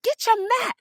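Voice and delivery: high, pressing mock command; man insisting she join him on the floor; insistent demand. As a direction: push hard, no arguing. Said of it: "he's very insistent".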